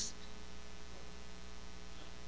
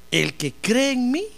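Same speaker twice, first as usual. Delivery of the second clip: very slow, fading to a near hush at the end; slow solemn declaration; 3 dB quieter, slower than usual. Acted. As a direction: let each word land, then drop away. Jesus's solemn declaration to Marta.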